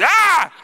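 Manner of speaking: put-on a short high yell like an angry toddler; mock kid's battle yell; son's yell. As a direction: yell like a small boy charging a wall.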